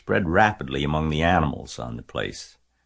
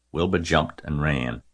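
plain reading voice

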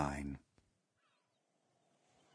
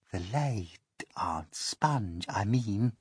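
slow, much softer aside; hushed correction; 6 dB quieter, much slower than usual. Acted. Miss Spider correcting herself, slow and hushed.

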